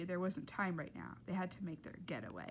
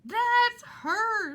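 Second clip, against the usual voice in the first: high, very loud shrieking panic; hysterical Rita; 13 semitones higher, 13 dB louder than usual. Acted. Rita shrieking at Ernie, high and very loud. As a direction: shriek it, drawing out the end. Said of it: "said Rita".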